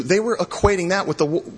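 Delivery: ordinary delivery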